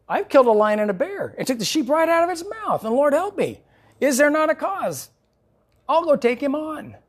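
high, eager boast; bright bravado of a boy hero; shepherd boy vowing to fight the giant; confident young David. Acted.

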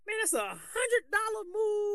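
very high, slow mocking coach voice; high-pitched coach impersonation; old coach's voice. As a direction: squeal it out like a coach scorning a fancy move. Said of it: "that's exactly how he sounded".

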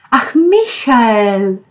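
slow, relieved sigh of the name. A narrator's reading. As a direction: let the tension go on the name. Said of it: "sagte Julia erleichtert". (erleichtert, langsam ausgeatmet)

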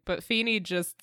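usual speaking voice

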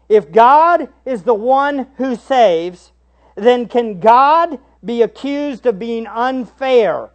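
preacher climbing to full force; building questions. Real, not acted. Rising rhetorical questions.

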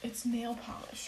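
flat whisper. Real, not acted. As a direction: whisper it back. Whispered reply.

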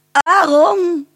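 high, loud call across the park. Acted. Lady calls her dog Aaron back, high and loud. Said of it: "riep ze".

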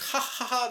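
high, spelt-out mock laugh; mocking ha-ha-ha of others; imitated laughter. Kids laughing as they drive off, imitated laughter. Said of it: "they're laughing".

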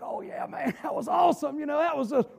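mock worn-out, hoarse excitement; imitated hoarseness. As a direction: enthuse through a shouted-out voice. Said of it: "I had shouted my voice gone".

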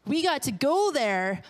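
ordinary talk, nothing marked

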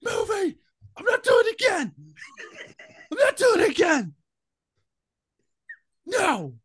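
very high, squealed character voice; shrill refusal. Acted. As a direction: refuse in a tiny screech way up high.

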